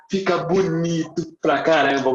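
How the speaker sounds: normal talking voice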